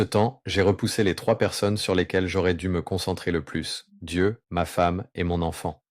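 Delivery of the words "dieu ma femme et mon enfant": ordinary talk, nothing marked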